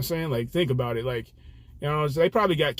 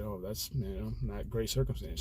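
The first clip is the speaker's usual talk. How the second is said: low, soft, slow condolence; quietly sorry, retelling an awkward reply; subdued sympathy; 4 semitones lower, 10 dB quieter than usual. Retold or imitated. Driver reacting to passenger's hospital news, subdued sympathy.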